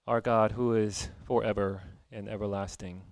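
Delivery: ordinary talk, nothing marked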